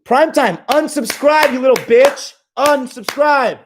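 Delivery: high, loud mocking chant; taunting. Streamer jeering at Prime Time to unsubscribe, high and mocking. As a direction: jeer at him, stretching the last word slow.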